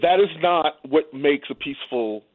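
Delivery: usual speaking voice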